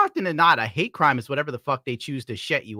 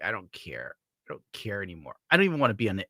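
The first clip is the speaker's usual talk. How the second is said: much softer, lower, checked-out mutter; apathetic mumble; 6 semitones lower, 6 dB quieter, faster than usual. Retold or imitated. Streamer imitating his own reaction to news, soft apathetic mumble. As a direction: mumble it like nothing matters anymore. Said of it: "I'm like".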